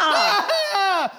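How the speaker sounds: high, loud shriek of fright; startled scream. Acted. Patient jumped by the doctor, startled scream. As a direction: jolt into a high, loud yelp.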